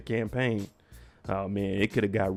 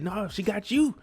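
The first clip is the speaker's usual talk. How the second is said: Carlton still going off; 9 semitones higher than usual. Retold or imitated. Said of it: "He said".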